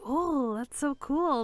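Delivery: wide-eyed admiring crowd reaction; imitated awe. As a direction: draw it out, mock impressed. Imitating impressed onlookers.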